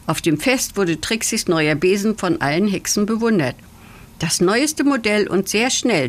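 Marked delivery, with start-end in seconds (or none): none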